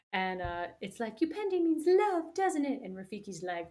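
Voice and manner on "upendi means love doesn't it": much higher, louder put-on voice; sing-song cartoon voice. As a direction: gush it like a cartoon sidekick.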